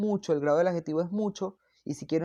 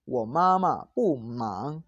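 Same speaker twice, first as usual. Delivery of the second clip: very slow Mandarin with drawn-out, swooping tones; slow model pronunciation; 3 semitones lower, much slower than usual. Shown as a demonstration. Teacher giving a negative example to students, slow model pronunciation. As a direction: stretch each syllable for learners.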